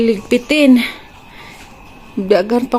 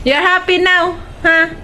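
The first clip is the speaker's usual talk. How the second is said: much higher cooing question; 8 semitones higher than usual. Real, not acted. Cooing at baby.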